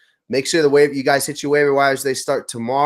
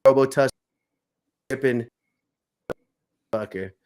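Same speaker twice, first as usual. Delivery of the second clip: ordinary delivery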